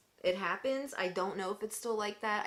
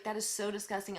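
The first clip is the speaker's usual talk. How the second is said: normal talking voice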